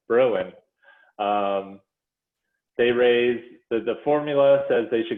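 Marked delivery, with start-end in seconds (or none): 0.1-0.5 "Berlin" with a louder, punched BER; stress on first syllable. Shown as a demonstration. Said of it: "I think it's Berlin, not Berlin".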